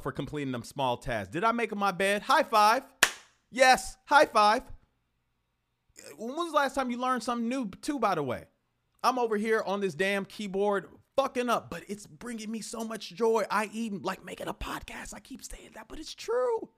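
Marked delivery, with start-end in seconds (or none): 2.2-4.6 higher, louder, slow cheer of high five; drawn-out celebratory high five; mock cheer. Rewarding yourself, cheering. 13.5-16.7 hushed confiding whisper; soft secretive aside. Real, not acted. Confiding aside about podcast.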